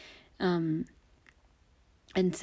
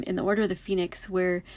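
ordinary talk, nothing marked

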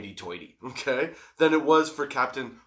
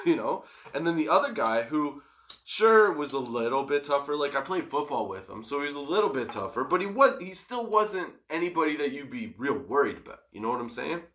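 normal talking voice

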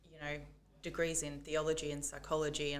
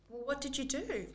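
higher, probing mum voice; 8 semitones higher than usual. Retold or imitated. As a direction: press like a curious mum.

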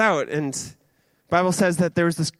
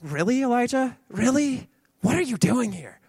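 high, incredulous sarcastic questioning; 6 semitones higher than usual. Acted. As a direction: ask it with mock disbelief. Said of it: "a bit of a sarcastic tone".

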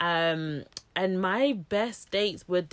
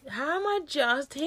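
disbelief; 7 semitones higher than usual. Real, not acted. Repeated disbelief.

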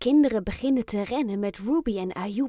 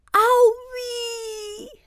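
high cry, loud then trailing thin; pained wail; 13 semitones higher, 5 dB louder than usual. A narrator's reading. Ayoub calls out from the ground, pained wail. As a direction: wail it out long and hurt. Injured Ayoub cries out again.

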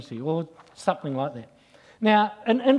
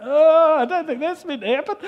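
alarmed passengers; 10 semitones higher, 5 dB louder than usual. Retold or imitated. Passengers react to the dropping plane.

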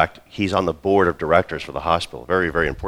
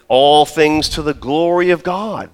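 church phrase; 8 semitones higher, 5 dB louder, slower than usual. Retold or imitated.

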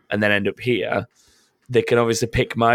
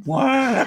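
duck noise; 14 semitones higher than usual. Retold or imitated. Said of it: "the weird duck noises".

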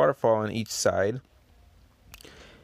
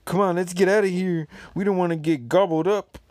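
put-on scared kid; 6 semitones higher, 4 dB louder than usual. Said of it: "he looks all scared with tears in his eyes".

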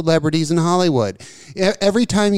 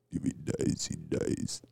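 whispered, much softer Russian deadpan; whispered Drago; 17 dB quieter, slower than usual. Retold or imitated. Whispering the Drago line to a friend. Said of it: "Fucking Dolph".

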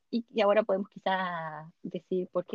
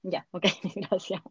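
nervous laugh; slower than usual. Real, not acted. Scared of spiders, laughing.

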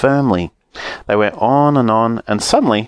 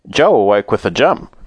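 plain reading voice

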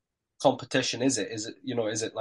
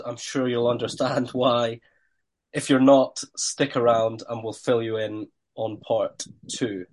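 ordinary talk, nothing marked

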